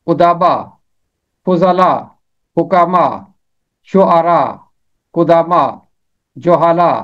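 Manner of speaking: each plural with short u first, final a; correct pronunciation list. Shown as a demonstration. Teacher giving the correct pronunciations in review. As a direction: read the list evenly, word by word. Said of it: "یہ ہے ان کا درست تلفظ".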